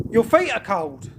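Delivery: normal talking voice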